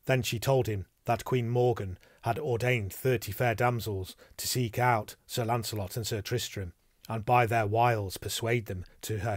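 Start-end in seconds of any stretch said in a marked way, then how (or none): none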